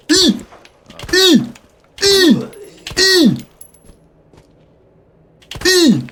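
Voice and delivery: isolated piercing falsetto bursts on I; sudden squeaks, spaced apart; squealed I vowels. Pretend. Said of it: "Des I".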